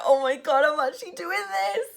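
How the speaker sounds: high, strained, near-tearful squeak; nervous squeal. Retold or imitated. Telling her story, replaying panic before client. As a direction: squeak it out like panic about to spill.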